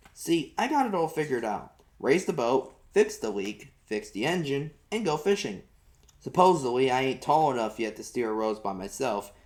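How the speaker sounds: ordinary delivery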